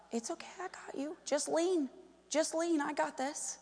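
hushed, tender quote of a supportive spouse; soothing reassurance. Retold or imitated. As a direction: imitate a husband gently comforting his wife. Voices husband comforting his failing wife.

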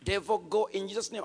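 repeated rebuke. Retold or imitated. Believer keeps repeating the rebuke for hours, loud. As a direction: repeat it like a tape recorder. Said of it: "I was like a tape recorder".

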